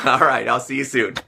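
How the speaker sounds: usual speaking voice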